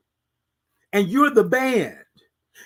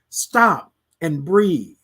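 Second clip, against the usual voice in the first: slow deliberate; 3 semitones lower, much slower than usual. Shown as a demonstration. Instructing to pause and breathe.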